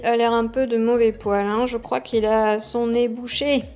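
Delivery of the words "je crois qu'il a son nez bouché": ordinary delivery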